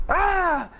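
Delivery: high playful scream, echoing; a toddler's happy shriek, retold; imitated toddler scream. Dad imitating his daughter's slide scream, high playful shriek. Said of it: "And I'd go".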